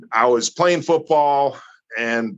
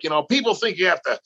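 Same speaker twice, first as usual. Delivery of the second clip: fast, slightly raised imitation of a German accent, half-voiced; thick German accent; 5 semitones higher, faster than usual. Telling about Carl Gotch, a light German accent at first. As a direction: start the German accent quickly, before you commit to it. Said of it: "he's got this thick German accent".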